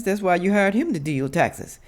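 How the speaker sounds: normal talking voice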